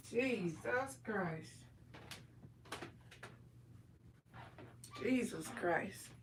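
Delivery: groaning it half to yourself; dismayed under breath. Real, not acted. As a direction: let it slip out in quiet despair. Dismayed at her Splenda's ingredients.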